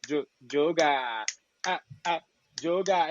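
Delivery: slow stretched "Joga", teasing; playful nickname call. Retold or imitated. Sheba shortening his name to a playful "Joga". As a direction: drawl "Joga" like a pet name. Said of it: "she just turned into Jo-, Joga".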